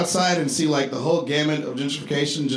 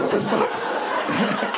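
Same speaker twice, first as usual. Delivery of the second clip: stunned; 6 semitones higher, much slower than usual. Retold or imitated. Stunned reaction to the girl.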